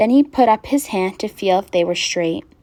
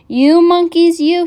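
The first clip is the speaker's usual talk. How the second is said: high, loud storybook scolding; scolding peddler; 8 semitones higher, 8 dB louder than usual. A narrator's reading. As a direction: scold the naughty monkeys loudly. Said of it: "shaking his finger at them".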